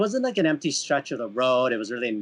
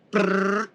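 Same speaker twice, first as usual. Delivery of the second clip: higher-pitched rolled motor brrr; imitated engine noise; 7 semitones higher than usual. Rider showing how he left the officer, imitated motor. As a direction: mimic a bike pulling away. Imitates bike leaving after reply.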